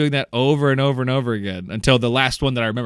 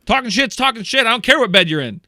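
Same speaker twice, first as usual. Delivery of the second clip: higher, louder heated retort; acted brother still furious, refusing to calm down; angry rant; 10 semitones higher, 3 dB louder than usual. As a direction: keep raging, no backing down.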